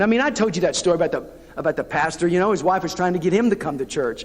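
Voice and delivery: ordinary delivery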